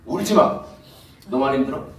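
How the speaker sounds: loud, high, laughing mock-annoyed God; irritated God. Preacher playing an irritated God scolding a crying believer, loud mock annoyance. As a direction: play God as a grumpy boss scolding, hammed-up. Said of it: "짜증을 내시면서".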